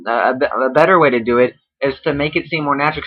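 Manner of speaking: ordinary talk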